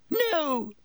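high-pitched panicked cry; terrified cry. A narrator's reading. Terrified Pettigrew begs for his life.

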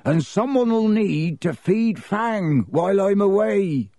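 high-pitched calling out. A narrator's reading. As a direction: announce it so hidden listeners hear. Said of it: "said loudly".

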